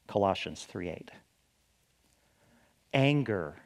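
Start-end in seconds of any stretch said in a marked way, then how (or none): none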